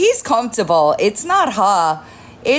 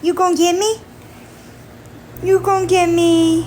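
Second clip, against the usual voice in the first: pet talk; 7 semitones higher than usual. Real, not acted. Playing with the cat.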